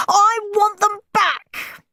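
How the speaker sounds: high, slow whining scream. Acted. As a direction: wail to the grown-ups. Steve's screaming continues.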